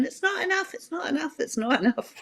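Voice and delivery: imitated shrill protest, falling in pitch; mock screaming. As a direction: mimic complainers in a high voice that drops with each repeat. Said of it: "the opposition parties screaming".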